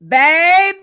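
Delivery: loud high calls fading down. Real, not acted. Host hyping Bernice King's speech, calls fading.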